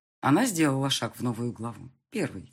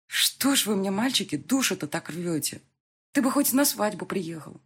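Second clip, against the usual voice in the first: higher, pleading mother's voice; 6 semitones higher than usual. Acted.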